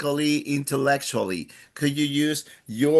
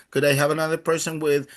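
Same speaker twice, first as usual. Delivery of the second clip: normal talking voice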